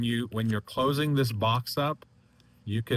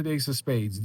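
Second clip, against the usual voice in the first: slightly raised, normal-paced acted reply; acted answer; 4 semitones higher, 3 dB louder than usual. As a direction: give the answer briskly, a little high. Acted answer, then repeated as reveal.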